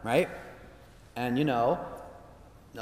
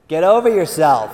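playful rebuke; 11 dB louder than usual. Retold or imitated. God's playful rebuke, retold.

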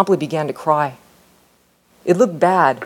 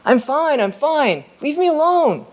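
high, tense, frightened pleading; hurt boy fends off the teacher, fearful and high; 11 semitones higher, slower than usual. Acted. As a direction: push him away with fear in the voice, pitched up. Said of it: "What it sounded like was fear".

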